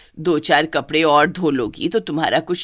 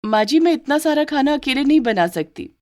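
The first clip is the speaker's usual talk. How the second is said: protesting maid; 8 semitones higher than usual. Acted. Servant protests workload.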